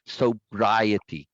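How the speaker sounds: "Sobriety" slowly, leaning on BRI; exaggerated syllable stress. Shown as a demonstration. Teacher showing syllable emphasis, exaggerated stress on "Sobriety". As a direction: stretch the second syllable of "Sobriety". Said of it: "I'm exaggerating".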